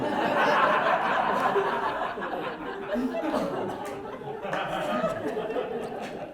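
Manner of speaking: long breathy giggle that rises; long cackle. Acted. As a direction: laugh at your own story. Laughs at mice story.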